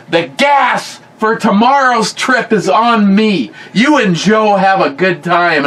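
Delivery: slow, high-pitched grandpa proclamation; emphatic grandfather. Retold or imitated. Grandfather funding tomorrow's trip for grandson, imitated emphatic generosity.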